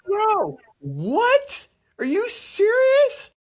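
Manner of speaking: high, loud, stretched outrage; outraged disbelief. Retold or imitated. Patient yelling at the doctor for pulling the wrong end. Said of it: "I was pissed".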